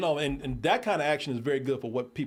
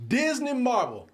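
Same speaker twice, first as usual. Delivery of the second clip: Disney pitched way up, drawn out slowly; heavy stress on DISNEY; 10 semitones higher, 3 dB louder, slower than usual. Shown as a demonstration. Podcaster hyping Disney with big emphasis. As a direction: hammer the word Disney up high. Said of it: "Let me put that emphasis on there".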